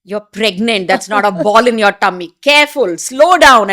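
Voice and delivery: loud scolding, rising to a yell; retold shout. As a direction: bark a warning at a trainee. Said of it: "Doctor Shekhar shouting at me".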